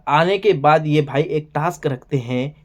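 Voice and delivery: ordinary talk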